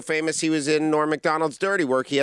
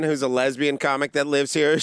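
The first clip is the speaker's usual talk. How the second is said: ordinary talk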